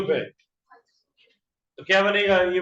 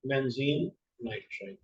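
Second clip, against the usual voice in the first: softer, lower repeat, word by word; dictating while writing; 4 semitones lower, 8 dB quieter than usual. Real, not acted. Teacher repeats compound name while writing it, quiet dictation. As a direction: murmur it along as you write.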